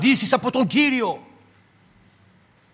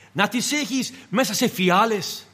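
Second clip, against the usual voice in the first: normal talking voice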